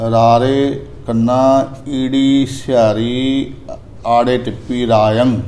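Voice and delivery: slow letter-by-letter spelling; spelling it out slowly. Shown as a demonstration. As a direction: spell each letter deliberately for the student. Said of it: "ਇਸ ਪ੍ਰਕਾਰ ਦਾ ਉਚਾਰਨ". (ਹੌਲੀ ਹੌਲੀ ਅੱਖਰ ਜੋੜ ਕੇ ਦੱਸਣਾ)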